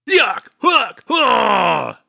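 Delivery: high shouted anime battle yells; battle cry. Acted. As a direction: yell like an attacking hero. Character shows off its moves.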